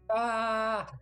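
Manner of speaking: high loud excited scream; sudden shrieking yell of glee. Real, not acted. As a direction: let out a big happy shriek. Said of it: "I like to scream about trees".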